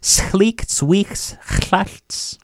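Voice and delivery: very slow, deliberate repeat; slow teaching model. Shown as a demonstration. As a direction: drag each word out for learners. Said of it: "And what do we say for that term?".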